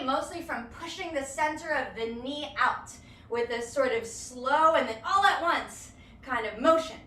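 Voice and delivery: ordinary talk, nothing marked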